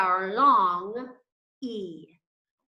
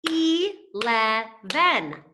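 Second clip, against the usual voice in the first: Eleven slow, louder, syllable by syllable; slow sounding out; 4 dB louder, much slower than usual. Shown as a demonstration. Teacher sounding out Eleven slowly for the kids. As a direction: stretch Eleven into three beats. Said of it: "like the number eleven".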